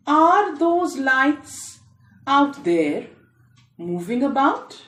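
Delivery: ordinary delivery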